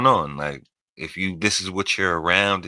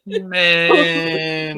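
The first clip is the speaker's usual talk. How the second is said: laughing; 10 semitones higher than usual. Real, not acted. Hosts laughing at the accent.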